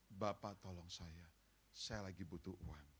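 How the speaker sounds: a meek whispered-like prayer for money; quiet humble plea. Shown as a demonstration. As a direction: beg God humbly and softly. Preacher acts out a humble prayer.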